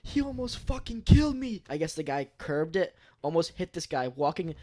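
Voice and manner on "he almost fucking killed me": high, loud outraged retold yell; angry yell. Man just out of prison yelling at the driver, angry yell. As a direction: spit it out like he nearly died. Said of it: "He was fucking pissed".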